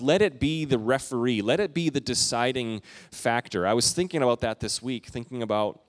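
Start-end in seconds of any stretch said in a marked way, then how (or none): none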